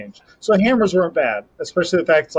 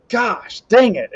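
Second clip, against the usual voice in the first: sharp, higher, louder burst of frustration; frustrated outburst; 7 semitones higher, 4 dB louder, slower than usual. Retold or imitated. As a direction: snap at yourself after a bad throw. Frustrated at a bad throw.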